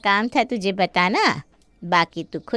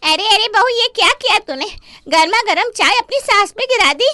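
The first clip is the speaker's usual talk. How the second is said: high, loud alarmed scolding; shocked scolding; 10 semitones higher, 6 dB louder than usual. Acted. Neighbour scolding the bride, high and alarmed. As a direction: scold her as if caught red-handed.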